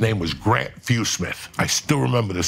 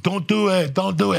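mock warning; 9 semitones higher than usual. Warning inmates again.

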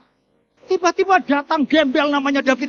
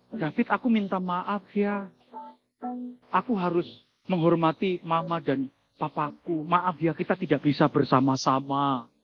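a quiet, sad goodbye from the ex; soft apologetic girlfriend; 8 semitones lower, 8 dB quieter than usual. Retold or imitated. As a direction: lower and much softer, gently apologising. Ex-girlfriend's farewell quoted.

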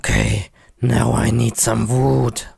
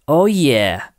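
usual speaking voice

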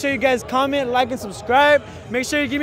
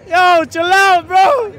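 yelling; 5 semitones higher, 6 dB louder, much slower than usual. Real, not acted. Host yelling at eager contestant.

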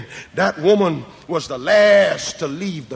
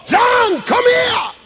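high, loud call from the cross; cried out; 4 dB louder, much slower than usual. A narrator's reading. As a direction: call out to John in anguish. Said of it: "Jesus cried from the cross".

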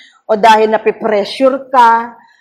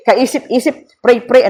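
ordinary delivery

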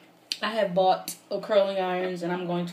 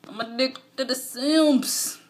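high, loud, slow wail; mock dramatic; 7 semitones higher, 6 dB louder, much slower than usual. Real, not acted. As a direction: ham up the confession. Dramatic addiction confession.

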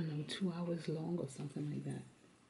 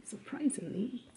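higher, louder playful surprise; mock surprise; 6 semitones higher, 4 dB louder than usual. Real, not acted. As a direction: act amazed you don't know. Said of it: "even I don't know".

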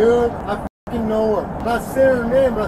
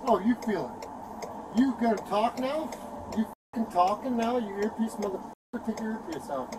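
softer, menacing taunts; low taunting; 4 semitones lower, 10 dB quieter than usual. Real, not acted. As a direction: needle him quietly. Arrestee taunting officer quietly.

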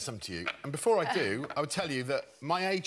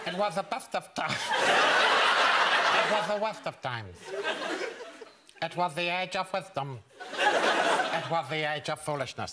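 lisping posh boxer reading Dickens; Chris Eubank impression; 4 dB louder than usual. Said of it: "I take a while to get Chris's voice".

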